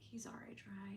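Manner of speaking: hushed unvoiced question; whispered. Wife whispering to her husband, hushed. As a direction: whisper it so nobody hears. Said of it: "I went".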